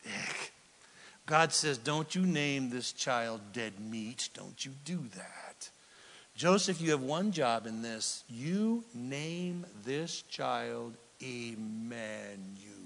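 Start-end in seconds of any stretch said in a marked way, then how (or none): 1.8-5.7 stern low warning. Acted. God voiced warning Joseph. 8.3-12.7 softer, very slow and deliberate; slow tender command. Acted. God telling Joseph gently, slowly, to name the child.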